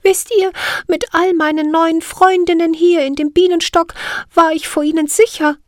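very high, clear little fairy voice; bell-like fairy voice. Acted. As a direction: ring the words out like a tiny bell. Siri voiced in story.

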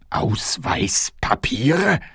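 very slow, whispered "Ausweispapiere?" with a rise; puzzled whisper. Acted. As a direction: whisper "Ausweispapiere?" slowly, not understanding. King baffled by the word.

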